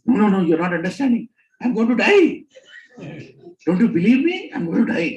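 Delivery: loud, high frantic insisting; panicked pleading. Retold or imitated. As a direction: beg her to believe you, panicking. Panicked insisting to sleepy wife.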